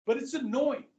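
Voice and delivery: ordinary talk, nothing marked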